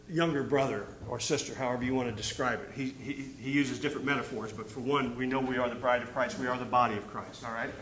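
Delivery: ordinary delivery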